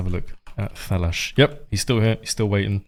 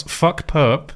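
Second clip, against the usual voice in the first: raised pitch, shouted like a lone king; mock shout; 5 semitones higher than usual. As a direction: call it out higher, at moderate volume. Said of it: "He shouts".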